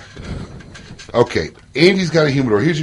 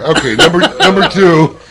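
louder, higher, through laughter; laughing; 6 semitones higher, 6 dB louder than usual. Real, not acted. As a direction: try to move on while cracking up. Host laughs while moving on.

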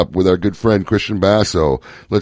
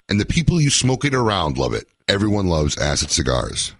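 ad read; 5 dB quieter than usual. Acted. Reads a cigar ad.

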